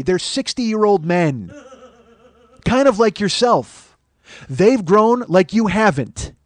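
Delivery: slow, loud, mocking jab; taunting. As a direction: rub it in slowly. Taunting the guy slowly.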